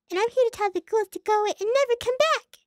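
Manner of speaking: high, loud little pony chirp; cutesy pony voice. Acted. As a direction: play the sweet little pony asking for mom.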